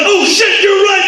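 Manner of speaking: retold startled concession; sudden realization. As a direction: catch yourself mid-yell. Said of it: "I'm like".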